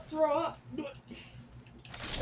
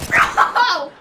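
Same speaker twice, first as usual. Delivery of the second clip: laughing; 7 dB louder than usual. Real, not acted. Laughing exclamation.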